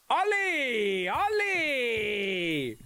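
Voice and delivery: high drawn-out call of a name. Real, not acted. Calling his friend Ollie across the room, long high call. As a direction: stretch the name out calling to him.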